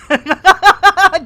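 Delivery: high, loud burst of laughter; cracking up. Real, not acted. Streamer tickled by the new acronym, cracking up. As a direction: laugh helplessly.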